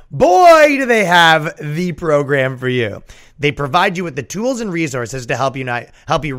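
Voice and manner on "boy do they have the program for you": loud drawn-out opening, then slowing; mock pitchman. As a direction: sell it like an over-the-top infomercial. Mock infomercial pitch for course.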